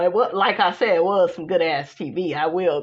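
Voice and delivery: usual speaking voice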